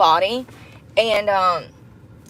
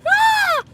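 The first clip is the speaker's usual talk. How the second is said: sudden high yelp of glee; high delighted shriek. Real, not acted. Vlogger cheering her own advice, delighted shriek.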